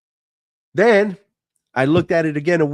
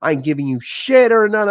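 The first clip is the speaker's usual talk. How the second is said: father's anger; 6 semitones higher than usual. Retold or imitated. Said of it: "He said".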